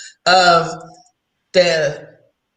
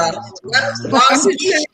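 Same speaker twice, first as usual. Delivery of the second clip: cat correcting skunk; 4 semitones higher, much slower than usual. Retold or imitated. Voicing the cat.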